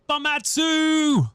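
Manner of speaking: high, slow, loud name call; excited greeting. Real, not acted. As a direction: sing out the name in delight.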